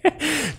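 short laugh that shoots high and soft. Real, not acted. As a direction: let a quick light laugh escape. Reacting to the sleep-hypnosis joke.

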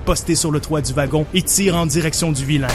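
narrating an action scene over music; dramatic story narration. Acted. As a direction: tell it like a movie trailer. Narrates his version of scene.

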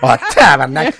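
loud startled Quebec oath; swearing outburst. Real, not acted. Host caught off guard, loud swearing outburst. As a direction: blurt the curse out loud.

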